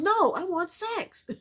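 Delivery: high, drawn-out mock whine of refusal; mock protest. Woman refusing to go to bed, mock protest. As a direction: protest it slowly and high, imitating her.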